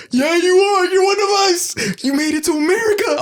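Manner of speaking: imitating the home countries cheering the one who made it; mock excited welcome. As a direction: cheer them on like proud relatives. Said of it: "they go".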